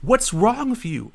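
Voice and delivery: mock scolding. Cashier scolding in parody.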